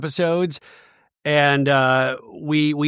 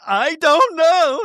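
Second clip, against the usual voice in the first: high, slow wail of "I don't know"; imitating a tearful, high wail; crying impression; 12 semitones higher, 4 dB louder, much slower than usual. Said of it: "And Lisa's like".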